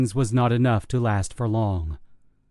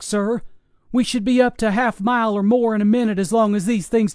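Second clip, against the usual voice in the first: soldiers on the radio; 6 semitones higher than usual. Acted.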